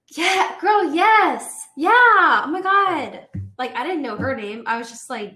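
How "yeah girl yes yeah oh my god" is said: mock enthusiasm. Faking enthusiasm back at girl.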